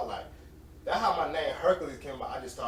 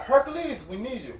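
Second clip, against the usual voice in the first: high summons, loud on the name; mock calling out; 8 semitones higher, 7 dB louder than usual. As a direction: call across the job site for help. Coworkers calling him for help.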